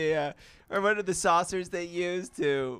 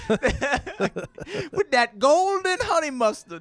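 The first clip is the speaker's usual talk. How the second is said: high, slow laughing prospector; old prospector; 13 semitones higher, much slower than usual. Pretend. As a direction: order fast food like an old miner. Prospector ordering at McDonald's.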